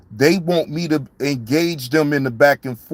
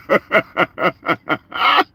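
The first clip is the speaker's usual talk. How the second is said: laugh; 4 semitones higher than usual. Real, not acted. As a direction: laugh rising at the end. Laughs after promising to stay quiet.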